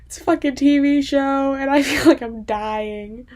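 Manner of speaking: real loud outburst over a TV show; exasperated outburst. Real, not acted. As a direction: blurt it out, loud and overwhelmed. Overwhelmed outburst about TV show.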